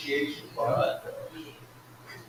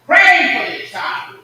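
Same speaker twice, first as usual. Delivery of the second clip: fervent preaching; 9 dB louder than usual. Real, not acted. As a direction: drive home the mother's prayer, loud. Preaching climax about praying mother.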